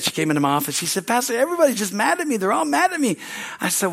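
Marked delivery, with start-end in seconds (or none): 1.1-3.2 a lightly voiced upset old lady, fast; mock her upset, rushed complaint; imitating upset lady. Old lady complaining to her pastor, a light mock upset. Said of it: "she was so upset".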